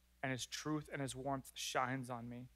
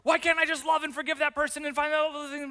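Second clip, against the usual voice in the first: much higher and louder, pleading with yourself; exasperated self-rebuke; 11 semitones higher, 9 dB louder than usual. Pretend. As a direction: vent the frustration at yourself in one breath. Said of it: "angry at yourself for being angry".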